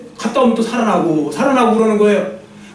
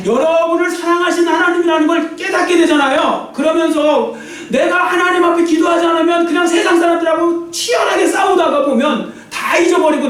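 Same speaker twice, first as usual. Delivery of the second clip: high, sustained preaching cry; impassioned sermon delivery, pitched high; fervent preaching; 7 semitones higher than usual. Real, not acted. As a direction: drive it home to the congregation. Preacher builds to a fervent peak.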